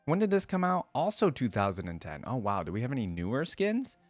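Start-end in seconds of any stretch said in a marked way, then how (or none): none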